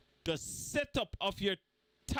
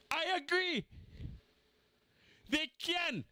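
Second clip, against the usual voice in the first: sarcastic agreement; 9 semitones higher than usual. Pretend.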